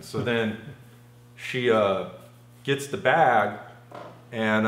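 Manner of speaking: ordinary talk, nothing marked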